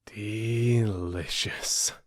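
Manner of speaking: slow, soft, savouring "Delicious"; drawn-out hungry fox purr; savoring fox. Acted. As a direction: taste the word like a hungry fox. Fox eyeing the cheese.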